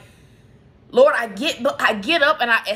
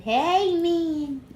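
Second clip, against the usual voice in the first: much higher sing-song hello to the dog; high dog greeting; 8 semitones higher than usual. Real, not acted. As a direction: lift up into a bright pet voice.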